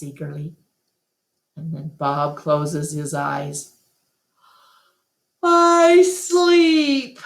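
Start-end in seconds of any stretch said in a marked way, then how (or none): 5.4-7.2 much higher, louder dog voice; sleepy punchline. Acted. Bob answering Ruby with a lazy punchline. Bob's punchline, closing his eyes.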